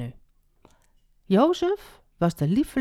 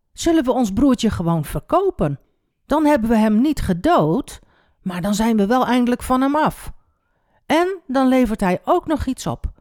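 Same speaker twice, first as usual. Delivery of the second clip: a touch higher, pitching a plan; lightly voiced schemer; 5 semitones higher, 4 dB louder than usual. A narrator's reading. Juda proposes selling their brother, lightly acted pitch. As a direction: lightly act out selling an idea.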